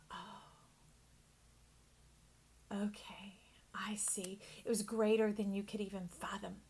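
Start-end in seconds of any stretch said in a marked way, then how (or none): 0.1-4.4 hushed realization. Retold or imitated. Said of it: "you begin to go".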